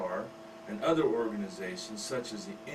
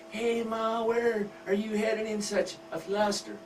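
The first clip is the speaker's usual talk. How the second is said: put-on higher, perky teen girl voice; teenage daughter; 9 semitones higher than usual. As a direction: play the nosy teenage girl. Teen daughter asks mother.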